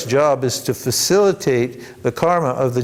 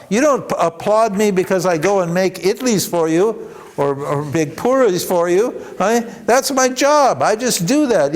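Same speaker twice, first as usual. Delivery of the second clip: raised-pitch angry rebuke; indignant, higher-pitched telling-off; imitating shouting guru; 6 semitones higher than usual. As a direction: retell a teacher's scolding with real push.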